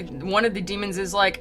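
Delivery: ordinary talk, nothing marked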